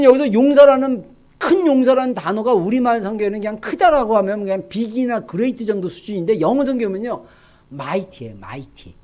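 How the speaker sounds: ordinary talk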